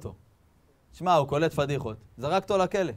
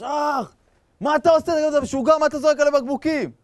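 high, loud acted protest; hammed-up indignant shouting; outraged yelp; 13 semitones higher, 7 dB louder than usual. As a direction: yell like someone just hit on the head. Acted man hit by thrown bottle.

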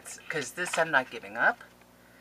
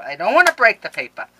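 higher, much louder plea; loud, swooping cry of dismay; loud anxious wail; 4 semitones higher, 14 dB louder than usual. Real, not acted. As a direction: wail it, fretting over the paper. Same words, low then cried out.

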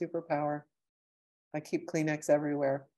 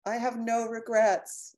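I have no regrets said high and slow, each word leaned on; slow, lifted, earnest I have no regrets; I have no regrets, raised and drawn out as if to a loved one; heartfelt declaration; 7 semitones higher, 4 dB louder, slower than usual. Retold or imitated. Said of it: "and just say".

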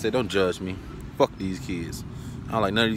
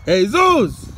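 loud, high call of the name; shouting a name; 11 semitones higher, 10 dB louder than usual. Real, not acted. Calling the kid Jesus by name, shouted. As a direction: shout his name across the yard.

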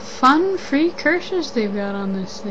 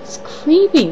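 creeped out; 4 semitones higher, 7 dB louder than usual. Real, not acted. Reacting to the creepy emoji.

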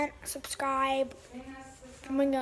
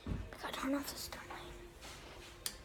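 whisper; 11 dB quieter than usual. Real, not acted. Kid whispering while switching off a light. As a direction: keep it hushed.

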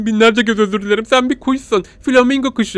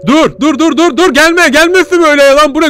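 panicked shouting; 9 semitones higher, 8 dB louder than usual. Real, not acted. Panicked at approaching monster.

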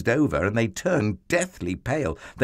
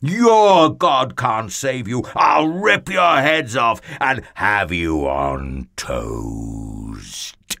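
Goliath bellowing; 5 dB louder, slower than usual. A narrator's reading. Giant threatens to eat the frightened army, bellowing. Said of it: "Goliath bellowed".

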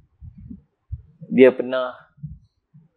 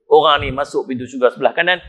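ordinary delivery

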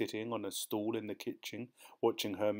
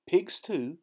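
high, louder appalled question; shocked disbelief; 8 semitones higher, 5 dB louder than usual. A narrator's reading. Boy asking his aunt, appalled at eating pigs. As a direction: blurt it out, horrified.

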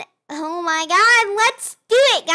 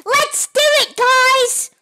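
high, loud bad Australian-British accent; 10 semitones higher, 6 dB louder, slower than usual. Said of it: "my Australian slash British accent".

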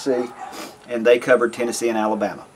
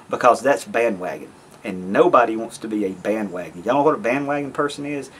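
usual speaking voice

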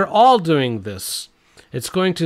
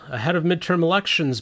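normal talking voice

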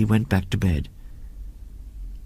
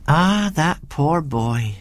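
long, slow sigh of sympathy, pitched up; housekeeper's wistful lament; sighing pity; 5 semitones higher, much slower than usual. A narrator's reading. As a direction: draw the words out pityingly. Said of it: "sighed Missus Bunch".

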